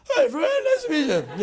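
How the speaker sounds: very high, strained, loud greeting; nervous wreck. Retold or imitated. Boy meeting Fran, a nervous wreck. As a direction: squeal it out, hopelessly flustered. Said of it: "forget it, I was a wreck".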